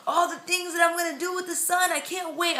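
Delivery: high, gushing imitation of an overjoyed dad; ecstatic husband. Wife imitating husband learning he'll have a son, ecstatic. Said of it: "he was ecstatic".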